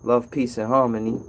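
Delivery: ordinary talk, nothing marked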